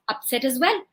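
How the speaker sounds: plain reading voice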